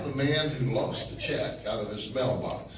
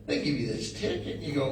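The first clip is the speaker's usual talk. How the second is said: usual speaking voice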